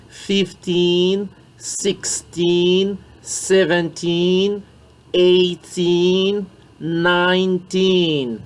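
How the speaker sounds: put-on slow, with the teen of each number drawn out; stressed teen ending. Shown as a demonstration. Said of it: "Il faudrait vraiment ressortir les in". (faire ressortir le teen, lentement)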